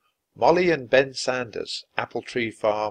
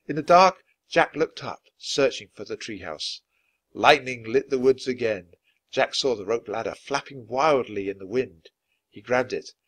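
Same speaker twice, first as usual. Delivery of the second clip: plain reading voice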